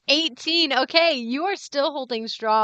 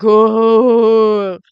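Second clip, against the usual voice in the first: zombie moan; 15 dB louder than usual. Acted. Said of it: "do his best zombie impression".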